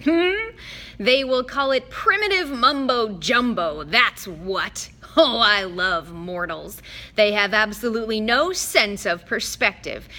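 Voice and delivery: a bored god sneering at mortals; Mister D sneering at mortals to Chiron; scornful god. Acted.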